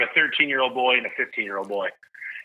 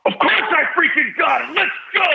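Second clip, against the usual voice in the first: joyful shout. Retold or imitated. Dad revealing to his kids he won, shouting with joy. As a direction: burst out with the big reveal. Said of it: "And I go".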